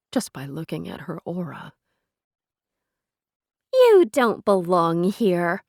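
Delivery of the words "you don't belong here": mocking. A narrator's reading. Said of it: "her mocking recitation".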